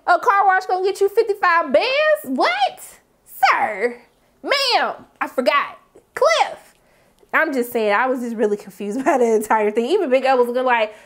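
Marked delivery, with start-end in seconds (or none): 0.1-6.7 high-pitched mock disbelief; playful scolding with leaps up in pitch; incredulous. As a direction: call him out in short, stunned bursts. Acting out her disbelief at Cliff.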